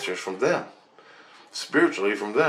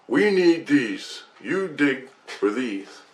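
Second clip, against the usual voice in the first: slow, higher, deliberate instruction; crew leader instructing; 4 semitones higher, much slower than usual. Retold or imitated. Leader tells the newbie what to dig for, slow instruction. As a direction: spell it out slowly, pitched up.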